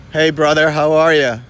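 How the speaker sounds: loud friendly shout; calling out. Real, not acted. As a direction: hail him from across the way. Calling out to passerby.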